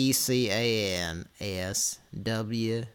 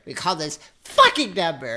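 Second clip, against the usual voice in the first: louder, higher furious shout; angry yell; 7 semitones higher, 10 dB louder than usual. Real, not acted. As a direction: yell it in a rage. Husband yells at wife.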